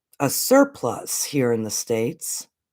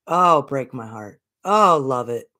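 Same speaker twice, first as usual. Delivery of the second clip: usual speaking voice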